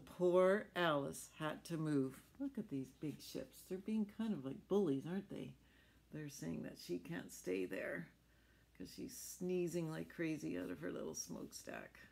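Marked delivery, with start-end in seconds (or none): none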